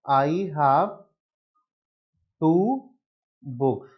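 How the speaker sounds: "I have ... two ... books" with long pauses; word-by-word dictation. Shown as a demonstration. Teacher showing the class how to write it, word by word. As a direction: dictate it slowly, leaving gaps before "two" and "books".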